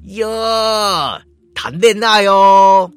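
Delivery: drawn-out taunting "yo", loud and slow; sneering thug taunt; mocking sneer. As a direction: jeer at the lovers like a thug. Bad guy mocks the couple.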